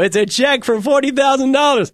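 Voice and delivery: excited announcement. Retold or imitated. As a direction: announce the big check with mock glee. Retelling showing off the check.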